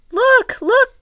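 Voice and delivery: put-on high excited cry of a boy; excited child. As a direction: shout it like a thrilled kid. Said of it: "cried a boy".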